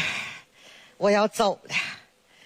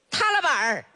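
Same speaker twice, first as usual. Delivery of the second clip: Northeastern dialect; 6 semitones higher than usual. Acted. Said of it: "东北话这".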